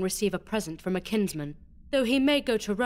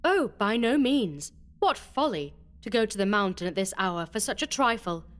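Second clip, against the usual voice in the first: bitter irony; 13 semitones higher than usual. Acted. Beatrice to Alonso, ironic and mocking. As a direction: feign worry to taunt him. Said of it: "bitter irony".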